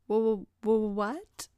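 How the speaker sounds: stammering, caught off guard; puzzled stammer. A narrator's reading. Tom, startled by Jen in her suit, stammering. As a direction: blurt it out in a stunned stutter. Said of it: "puzzled Tom blurted out".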